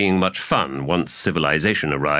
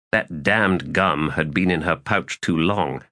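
ordinary delivery

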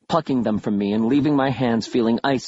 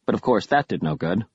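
ordinary delivery, nothing marked

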